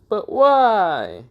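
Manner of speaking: high, drawn-out mock whine; whiny protest. Bear objects to being told to cook, whiny protest. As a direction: wail it like a sulky protest.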